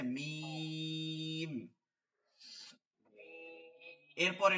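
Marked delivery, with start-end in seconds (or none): 0.0-1.7 very slow, humming মিম; মিম held long through closed lips; drawn-out mim. Shown as a demonstration. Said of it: "মিম হয় হচ্ছে এইখান থেকে". (মিম টেনে লম্বা উচ্চারণ)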